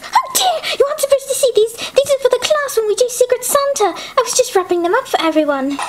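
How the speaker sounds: put-on high, fussy girl caught wrapping gifts; flustered high girl. Caught wrapping Secret Santa gifts, she fusses in a high voice. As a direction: hide the presents in a fluster.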